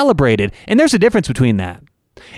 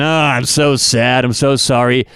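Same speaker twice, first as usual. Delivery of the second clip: slow, low, hammed-up sad whine; mock sorrow; 4 semitones lower than usual. Host mocking the remorse the athlete should show, hammed-up sad. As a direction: pretend to be wallowing in regret. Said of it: "like, in the depths".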